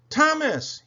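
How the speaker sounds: high, loud happy call; Stanley, whistling happily. A narrator's reading. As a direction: call a friend's name joyfully. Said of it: "he whistled happily".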